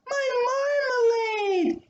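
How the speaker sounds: thankful exclamation. A narrator's reading. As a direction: exclaim it with relief. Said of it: "he exclaimed thankfully".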